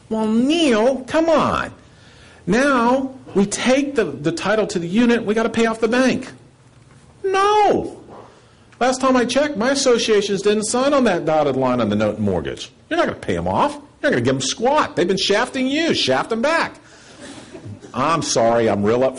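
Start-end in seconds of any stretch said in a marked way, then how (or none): 0.1-6.3 higher, hammed-up voice of a doubting board; mock board objection. 7.2-16.8 sarcastic and much higher, pushing back on the banks. Real, not acted. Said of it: "You can tell I like to be sarcastic".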